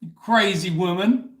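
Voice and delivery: high, loud, slow telling-off; mock shout. Real, not acted. Telling a friend fifty-five is not old, loud mock scolding. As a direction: bellow it, half joking.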